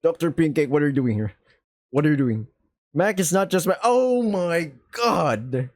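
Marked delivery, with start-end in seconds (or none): 3.8-5.7 drawn-out, very slow high gasp; shocked gasp. Acted. Girlfriend stops mid-confession in mock shock. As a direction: stretch the shock out.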